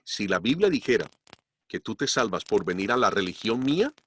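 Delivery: usual speaking voice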